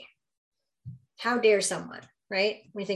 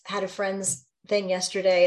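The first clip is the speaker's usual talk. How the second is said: ordinary talk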